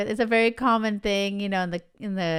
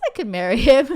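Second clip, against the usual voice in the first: laughing; 6 semitones higher, 9 dB louder, faster than usual. Real, not acted. Giggling at childhood answer.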